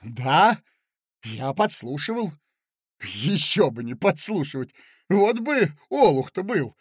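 loud coarse defiant reply; rude retort. Acted. Mozglyakov answering Marya rudely, angry. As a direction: fling it back rudely, stoking his anger. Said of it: "грубо отвечал Мозгляков".

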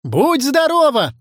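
high, slow collective cry; big drawn-out blessing; shouted like a chorus. Acted. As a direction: call it out with all your might. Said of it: "хором закричали ученики".

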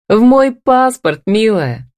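slow, high, playful punchline; joyful. A narrator's reading. Husband telling his wife about his passport, merrily teasing. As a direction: draw it out with light glee. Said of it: "радостно заржал Дема".

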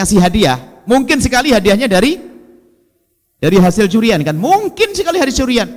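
ordinary delivery

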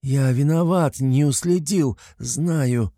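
nasal, through a broken nose; talking with a smashed nose. A narrator's reading. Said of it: "Выставляет вперёд ладонь Гундося".

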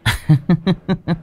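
high, measured villainous chuckle; mocking laugh. A narrator's reading. Creditor applauding the family, mocking laugh.

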